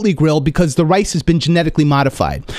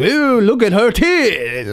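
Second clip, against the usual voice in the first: high, drawn-out garbled holler; mock yelling; 6 semitones higher, much slower than usual. Kitchen staff yelling as you walk through, mock yelling. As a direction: imitate a kitchen crew calling out, stretched slow. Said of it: "they're all yelling".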